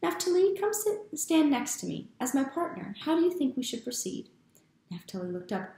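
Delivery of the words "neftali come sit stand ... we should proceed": cheerful uncle. Acted.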